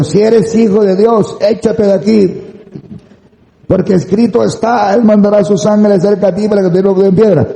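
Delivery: high-pitched mock challenge; Satanás tentador. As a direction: imitate a goading tempter, voice held high throughout. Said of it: "Cuando Satanás le dice". (tentador desafiante, imitado con voz aguda)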